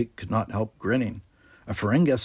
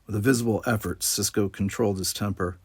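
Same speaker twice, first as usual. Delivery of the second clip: ordinary delivery, nothing marked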